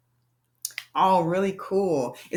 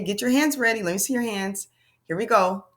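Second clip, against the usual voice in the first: usual speaking voice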